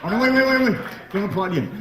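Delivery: high, hurried string of waits; high-pitched comic protest; mock urgent. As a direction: cut them off in a high, urgent voice. Stops audience applauding too early.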